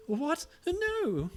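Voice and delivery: very high, loud squeal of protest; flustered denial. Acted. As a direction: squeak it out, mock-scandalized. Neon flustered by teasing about crush.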